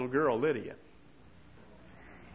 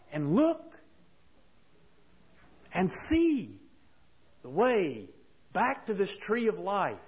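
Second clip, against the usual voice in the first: higher, rising preacher's climax; impassioned preaching; 6 semitones higher than usual. Acted. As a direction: build the questions like a revival preacher. Sermon builds to climax.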